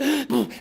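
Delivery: mock gasps for air; breathless puffing. Guy stepping and stepping, acted gasping. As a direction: puff hard, as if winded. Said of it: "and he can't breathe".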